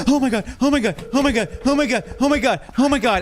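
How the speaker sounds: high, panicked repeated gasps; freaking out after a jump scare; panicked gasping. Real, not acted. Jump-scared by game character.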